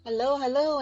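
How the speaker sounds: normal talking voice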